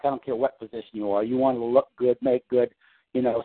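normal talking voice

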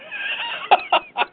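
short burst of laughter; laughing. Real, not acted. Laughs at his own joke.

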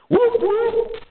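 high whooping police siren; siren imitation. Narrating cops arriving, high mock siren whoop. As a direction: mimic a cop siren, high.

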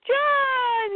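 very high, long wail of a name; acted cry for help.

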